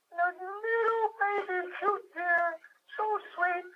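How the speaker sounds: high, hushed cutesy voice; cooing over baby deer. Retold or imitated. Remembering the petting zoo deer, high, soft cooing. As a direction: coo it like you're melting over something tiny.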